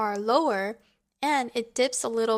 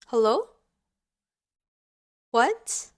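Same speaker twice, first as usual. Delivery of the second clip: steep upward sweep on "Hello?" and "What?"; rising and questioning; 5 dB louder, slower than usual. Shown as a demonstration. Teacher showing second tone in English, questioning rise on "Hello?" and "What?". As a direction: ask "Hello?" and "What?" with pitch climbing sharply. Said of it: "sounds rising and questioning".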